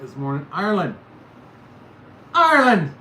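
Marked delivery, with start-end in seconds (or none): 0.5-0.9 put-on Irish accent. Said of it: "This is the Irish side of me". 2.3-2.9 put-on loud, high Irish accent. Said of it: "This is the Irish side of me".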